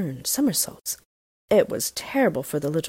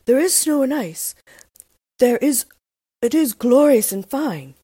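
a touch louder, lightly voiced reindeer; proud reindeer; 7 semitones higher, 7 dB louder than usual. A narrator's reading. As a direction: speak fondly of your homeland. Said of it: "said the reindeer".

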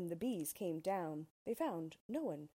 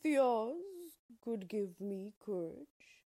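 slow, louder lion voice, a different timbre; a timid big lion asking for courage; slow and hopeful, like a shy beast; Cowardly Lion; 4 semitones higher, 6 dB louder, slower than usual. Acted. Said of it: "the Cowardly Lion asked Dorothy".

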